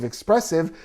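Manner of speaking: ordinary talk, nothing marked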